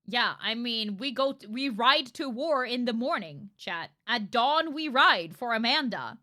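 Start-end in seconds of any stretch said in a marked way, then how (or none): 1.5-6.2 loud mock war call; rally the troops, hammed-up; mock battle cry. Streamer rallying chat to avenge Amanda, loud mock battle cry.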